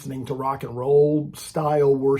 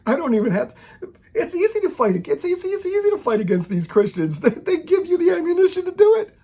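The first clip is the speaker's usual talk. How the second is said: put-on much higher, laughing as he retells his old self; laughing mocking scoffer; 13 semitones higher than usual. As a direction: cackle at how easy the fight was. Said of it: "it was like".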